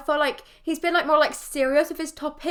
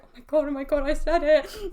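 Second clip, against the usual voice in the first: put-on giggly kid excitement. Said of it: "you get all excited".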